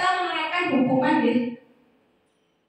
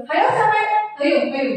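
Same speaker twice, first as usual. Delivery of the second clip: scolding a noisy class, raised voice; high scolding voice; 7 semitones higher than usual. Shown as a demonstration. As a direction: call out the culprit, voice up high. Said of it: "nada suara tinggi".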